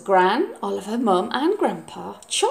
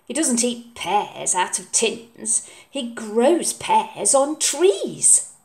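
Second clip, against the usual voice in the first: slow, deliberate correction; slow child voice making a point; slow, pointed boy; slower than usual. Acted. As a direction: lecture mum on proper pears. Oliver's voice.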